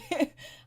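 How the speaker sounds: high, short burst of laughter; amused laugh. Real, not acted. Reviewer amused by the thirty thousand dollar debt, amused laugh. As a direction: laugh in disbelief.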